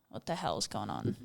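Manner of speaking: low, hushed imitation of baffled parents; puzzled parents. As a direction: mutter it under your breath, puzzled. Voices puzzled parents, muttered low.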